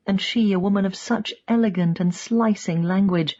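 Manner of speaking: ordinary delivery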